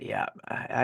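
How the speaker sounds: breathy private whisper, barely voiced; hushed inner thought; whispered aside. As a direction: say it under your breath to yourself.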